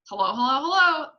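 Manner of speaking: high, loud parrot call; loud and clear. A narrator's reading. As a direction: rise to a loud squawked greeting. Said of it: "a voice came loud and clear".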